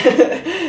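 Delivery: short high burst of a laugh. Real, not acted. Reader laughing at her own song thoughts, light laugh. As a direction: laugh at the song titles that come to mind.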